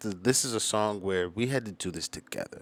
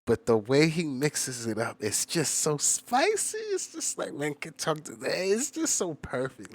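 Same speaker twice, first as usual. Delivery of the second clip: excited gushing; 7 semitones higher than usual. Real, not acted. Gushing about the verse's flow.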